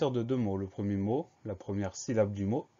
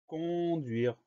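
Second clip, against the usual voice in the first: dictation; 5 semitones higher, 4 dB quieter than usual. Shown as a demonstration. Dictating the new word.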